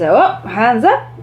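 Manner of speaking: high bright teacher instructions; upbeat teacher giving actions; classroom commands. Shown as a demonstration. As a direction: call the kids' moves out cheerfully. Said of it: "another way of doing that is".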